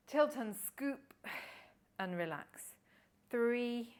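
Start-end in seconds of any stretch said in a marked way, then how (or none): 0.1-2.6 drawn-out cue. Shown as a demonstration. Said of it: "coaching the movement".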